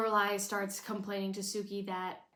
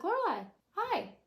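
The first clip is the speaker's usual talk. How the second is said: much higher, louder gushing greeting; sing-song Miss Patty; 11 semitones higher, 4 dB louder than usual. Retold or imitated. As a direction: gush a high hello across the studio. Miss Patty greets Lorelai brightly.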